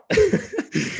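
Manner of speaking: high, bright burst of real laughter; sudden amused laugh. Real, not acted. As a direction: laugh out loud at the memory. Laughing at his own story.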